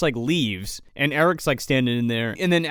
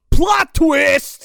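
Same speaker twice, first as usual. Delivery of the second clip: high, loud mock-announcer holler; mock dramatic shout; 9 semitones higher, 7 dB louder than usual. Podcast host mocking the film's big twist, mock dramatic shout. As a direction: belt it out as a sarcastic big reveal.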